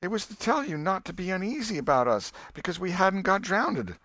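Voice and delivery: put-on a boy begging to be believed; pleading boy. Tom pleading with his aunt that he meant no harm.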